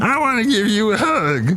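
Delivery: high-pitched silly character voice; comic visitor. Acted. Visitor wanting to hug the co-host, high character voice. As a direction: offer affection in a put-on high voice. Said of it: "Hey, look who's here".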